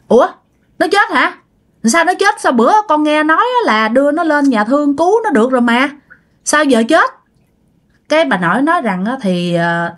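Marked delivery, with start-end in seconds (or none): 0.1-7.1 retold shocked questions, pitched much higher; the father stunned that the girl died, voice raised high; shocked disbelief. Father shocked hearing of death. (giọng cha kinh ngạc, cao vút)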